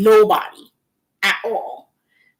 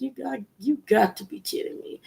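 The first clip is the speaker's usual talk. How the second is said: hushed disbelief; 4 semitones higher, 9 dB quieter than usual. Retold or imitated. Alone in dark bathroom, seeing shadow figure, quiet disbelief. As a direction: say it under your breath, stunned. Quoting her own disbelief.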